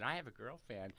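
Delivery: usual speaking voice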